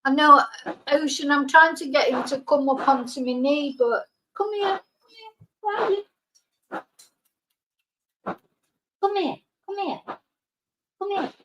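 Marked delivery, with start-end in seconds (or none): 4.4-11.3 coaxing dog. Real, not acted. Calling frightened dog to her.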